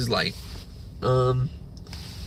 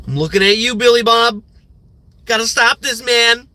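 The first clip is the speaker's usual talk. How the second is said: calling out; 7 semitones higher, 4 dB louder than usual. Real, not acted. Calling out coworker.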